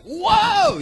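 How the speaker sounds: high, loud burst of excitement; excited whoa. Shown as a demonstration. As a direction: yelp it out like you're thrilled. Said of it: "What's the wackiest thing that you do".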